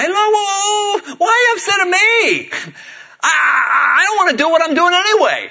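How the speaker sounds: high, loud whiny protest; Moses. Acted. Reluctant leader Moses protests to the people, hammed-up whining. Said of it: "I love what, uh, Moses is going".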